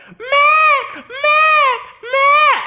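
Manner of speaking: high, loud crying shriek; terrified screaming. Retold or imitated. Shelby screaming for Matt while Pigman chases her, crying shriek. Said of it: "So she's like".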